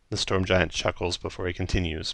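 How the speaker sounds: ordinary talk, nothing marked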